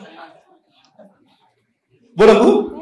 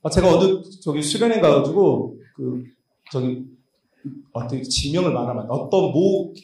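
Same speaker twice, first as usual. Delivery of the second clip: normal talking voice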